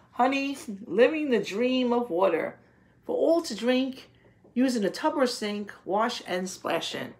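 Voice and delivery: lilting read-aloud of a poem; reading a picture book. Acted. Teacher reading a rain poem aloud to her class, lilting. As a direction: read it like a storybook to children.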